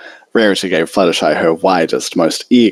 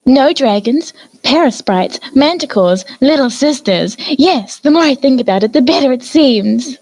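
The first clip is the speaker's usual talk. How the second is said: higher, refined lady's teasing voice; Rarity defending Fluttershy and teasing Twilight, refined and higher; genteel Rarity. A narrator's reading. Rarity listing dangers.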